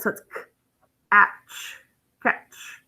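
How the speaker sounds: k, then atch, then catch, whispered and blended; soft phonics sounding-out of catch. Shown as a demonstration. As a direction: sound out catch one piece at a time, softly. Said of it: "a vowel followed by a consonant".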